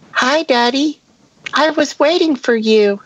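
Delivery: put-on high, eerie child's voice; a spooky little daughter greeting; creepy little girl voice. Daughter voiced in read story.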